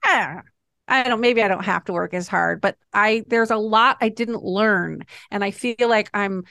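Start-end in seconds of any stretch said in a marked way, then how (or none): none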